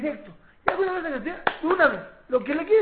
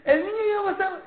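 put-on higher, louder, a whimpering kid's voice; hammed-up tearful child; mock crying child; 9 semitones higher, 6 dB louder than usual. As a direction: play the frightened little boy, half crying. Acting out a shaken child.

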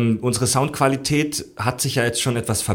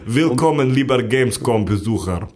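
slow, badly dubbed Russian accent; 3 semitones lower than usual. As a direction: play a clumsy Russian-accented voice actor. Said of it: "Synchronsprecher mit russischem Akzent".

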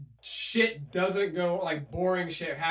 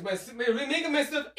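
put-on light Russian accent, pitched up; 7 semitones higher than usual. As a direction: slip into a playful Russian accent. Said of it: "doing a Russian accent for whiskey".

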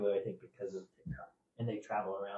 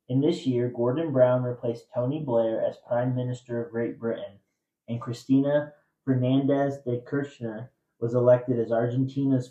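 loud, measured trivia question read; quiz host reading; 12 dB louder than usual. Acted. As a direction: read the clue out to the room. Host recaps the clues.

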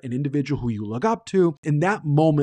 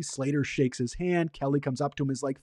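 ordinary talk

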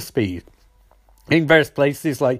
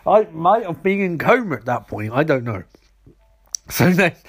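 normal talking voice

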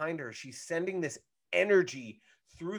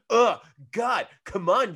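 higher and louder, fed-up griping; annoyed groan; 5 semitones higher, 7 dB louder than usual. Retold or imitated. Brother soaked by the bubble, complaining to his sister, annoyed. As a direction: grumble like a sulky brother who got splashed. Sokka annoyed after getting wet.

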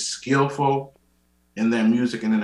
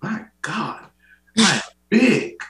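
high-pitched cry of dismay, jumping louder; mock dismayed exclamation; 10 semitones higher, 5 dB louder, much slower than usual. Grandmother trying on clothes before church, mock dismay. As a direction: imitate a grandmother's shocked outburst.

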